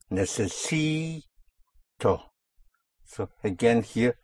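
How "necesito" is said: "Necesito" with SI held long; penultimate stress. Shown as a demonstration. Teacher showing the push again, "Necesito" stressed long on SI. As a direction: stretch SI, then drop a short TO in "Necesito". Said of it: "on the penultimate syllable".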